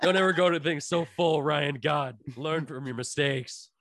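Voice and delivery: hammed-up telling-off of yourself; mock self-scolding. Host berating past Ryan for the spoonful, mock exasperation.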